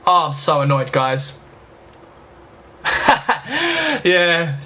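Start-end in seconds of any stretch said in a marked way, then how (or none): 2.8-4.0 high breathy laugh at own rage; sheepish burst of laughter; rueful laugh. Real, not acted. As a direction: laugh off the outburst. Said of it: "I do react like that".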